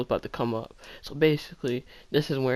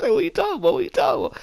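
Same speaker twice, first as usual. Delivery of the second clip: flustered denial; 4 dB louder, faster than usual. Retold or imitated.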